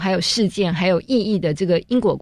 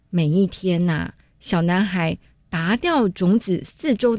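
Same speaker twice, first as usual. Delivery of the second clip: rhythmic read-aloud. Shown as a demonstration. Teacher demonstrating rhythmic picture-book reading to parents. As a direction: read it like music, long notes then quicker ones. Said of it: "我在这边就示范念一次哈".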